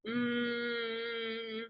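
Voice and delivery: long, soft, level "mmm"; drawn-out hum. Retold or imitated. Speaker showing what those hours were like, long hum.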